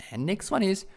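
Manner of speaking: usual speaking voice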